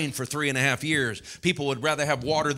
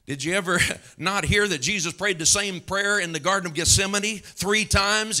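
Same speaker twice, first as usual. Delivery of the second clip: usual speaking voice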